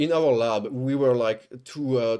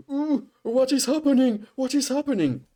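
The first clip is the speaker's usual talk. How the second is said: much higher mock-concerned voice; alarmed onlookers; 11 semitones higher than usual. People rushing to help after the scream, mock alarm. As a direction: imitate a startled crowd, pitched way up.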